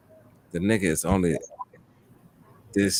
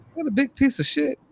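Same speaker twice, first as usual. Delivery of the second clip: much higher, disbelieving question; shocked; 4 dB louder than usual. Retold or imitated. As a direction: retell the moment you spot it on the floor. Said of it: "I said".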